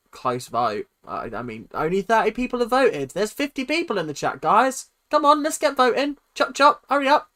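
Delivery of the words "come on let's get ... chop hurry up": loud, high hurry-up; urgent chivvying. Real, not acted. Streamer hurrying the chat to vote, loud chivvying. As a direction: call out briskly to get them moving.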